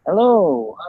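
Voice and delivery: ordinary talk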